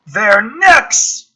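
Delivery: high, loud urgent shout; mock battle yell. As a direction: yell it over gunfire. Soldier yells in battle.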